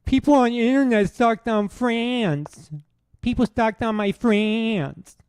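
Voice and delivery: put-on high, loud crying-child parody; Bill Burr. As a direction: wail it like a whining baby, mocking. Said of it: "You sound like a child who's crying".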